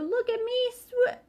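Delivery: high squeaky little voice; squeaky Roo. A narrator's reading. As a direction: squeak it like a tiny joey. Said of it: "said Roo".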